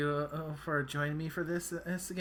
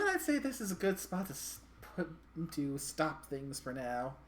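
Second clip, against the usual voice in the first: ordinary delivery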